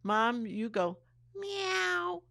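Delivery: mom's meow line, high and loud; imitating a meow cue. Quoting son telling mom to meow, high mock voice. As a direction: play the silly cat cue. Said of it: "he said".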